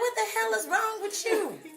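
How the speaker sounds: high, loud mock outrage; retold incredulous scolding. Wife retells scolding her husband.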